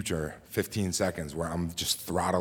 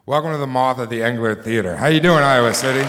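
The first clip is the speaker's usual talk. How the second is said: loud, high announcer welcome; host welcome; 9 semitones higher, 7 dB louder, faster than usual. Acted. Host welcoming the Moth audience, loud and high. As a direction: greet the crowd loud and bright.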